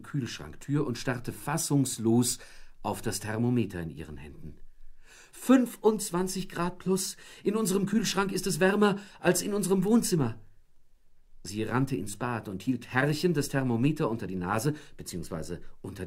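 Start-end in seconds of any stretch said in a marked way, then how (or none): 5.3-10.4 shocked wife. A narrator's reading. Wife shocked at warm fridge.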